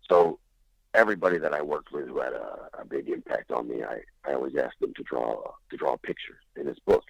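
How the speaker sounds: lightly voiced older friend, low and halting. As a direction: stammer it out low and unsure. Friend explains his book.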